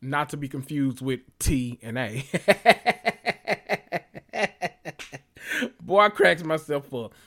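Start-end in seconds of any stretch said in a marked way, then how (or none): 2.3-5.2 long high cackling laugh; real, sustained giggling fit. Real, not acted. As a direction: crack up at your own joke. Laughing at own joke.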